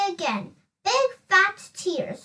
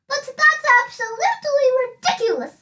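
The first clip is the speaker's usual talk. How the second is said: indignant protest; 6 semitones higher, 4 dB louder, faster than usual. A narrator's reading. Miss Giggles protests the empty box.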